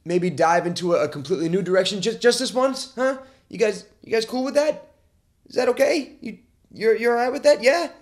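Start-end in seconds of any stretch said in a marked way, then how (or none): 2.0-7.9 higher-pitched, tentative pleading for approval; coaxing. Acted. Host asks listeners to allow a detour, coaxing. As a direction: check hopefully that everyone is on board.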